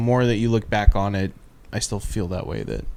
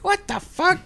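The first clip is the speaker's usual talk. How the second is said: baffled outrage; slower than usual. Retold or imitated. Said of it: "people are gonna be like".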